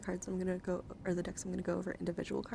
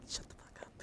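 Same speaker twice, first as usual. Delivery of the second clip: whispered, amused retort; hushed quote; 13 dB quieter than usual. Retold or imitated. Streamer quoting Katie's reaction to the joke, hushed. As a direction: quote it under the breath.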